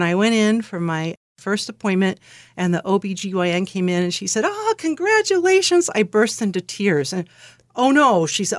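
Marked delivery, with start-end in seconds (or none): 4.5-5.9 gushing high-pitched congratulations; delighted congratulations. Retold or imitated. Doctor congratulates a newly pregnant patient, delighted. 7.8-8.3 imitated dismayed "oh no", louder and higher; retold concern. Doctor sees her patient burst into tears, alarmed concern.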